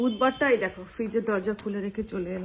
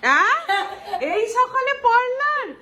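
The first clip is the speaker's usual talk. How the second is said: much higher, louder, hammed-up shock; mock outrage; 8 semitones higher, 5 dB louder than usual. Husband mock-scolding wife for a morning parlour trip. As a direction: tease her with fake scandal.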